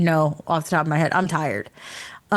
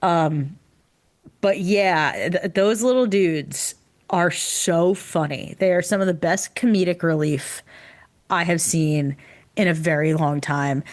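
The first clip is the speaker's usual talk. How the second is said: ordinary talk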